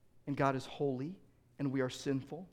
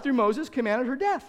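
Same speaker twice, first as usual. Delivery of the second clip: ordinary talk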